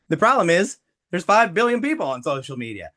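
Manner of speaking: ordinary talk, nothing marked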